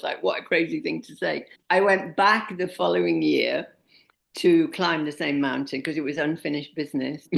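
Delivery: normal talking voice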